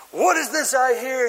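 high, over-the-top royal indignation; melodramatic king. Acted. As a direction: play an outraged stage king. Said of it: "it's way over the top".

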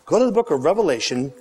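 normal talking voice

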